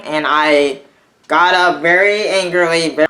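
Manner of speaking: ordinary talk, nothing marked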